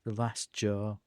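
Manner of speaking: put-on slow, low, unhurried old fellow; low-pitched old-timer taking his time; old man character. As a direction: play an easygoing old man, voice dropped.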